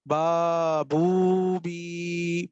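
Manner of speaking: با بو بی each vowel held very long and slow. Shown as a demonstration. Teacher demonstrating long vowels با بو بی for students. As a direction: chant با بو بی stretching every vowel. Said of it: "یہ جو لمبا کر کے پڑھنا ہے".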